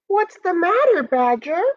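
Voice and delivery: high, tiny-creature voice; small ant piping up, pitched high; little ant. Acted. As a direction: ask with concern in a high little voice. Little ant speaks to badger.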